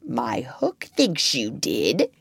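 low, slow, purring menace. A narrator's reading. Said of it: "purring again".

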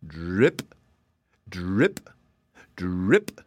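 low drip that flicks up at the end; dripping sound effect. A narrator's reading. Storyteller voicing butter melting off a hat, low dripping. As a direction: imitate slow, separate drips of melting butter.

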